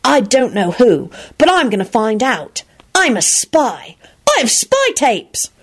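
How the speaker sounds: slow, secretive boy playing spy; mysterious. A narrator's reading. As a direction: draw it out like a big secret. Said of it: "Timothy replied mysteriously".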